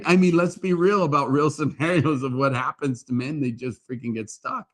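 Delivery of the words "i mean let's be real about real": ordinary delivery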